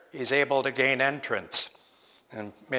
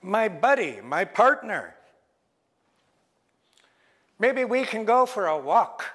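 louder, chummy trickster bird; wheedling Raven; 5 semitones higher, 4 dB louder than usual. Acted. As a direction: coax them like an old pal. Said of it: "Raven says".

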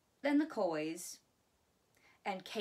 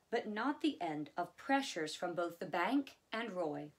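ordinary delivery, nothing marked

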